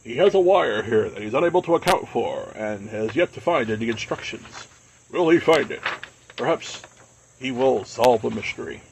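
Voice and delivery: hammed-up mystery show narrator; Robert Stack narrator. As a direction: announce it like an unsolved-mysteries host. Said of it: "my very poor Robert Stack impression".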